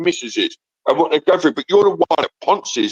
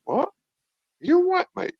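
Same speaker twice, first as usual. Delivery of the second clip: incredulous; 14 semitones higher, 5 dB quieter, slower than usual. Real, not acted. Disbelief at crude question.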